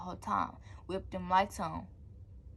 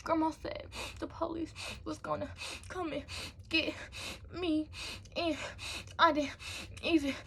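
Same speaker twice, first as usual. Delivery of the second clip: high-pitched, gasping fake sobs between words; mock crying; 9 semitones higher than usual. Child telling papa about the police, mock crying. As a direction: sob it out word by word, hammed-up. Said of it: "I start crying, y'all. I'm crying".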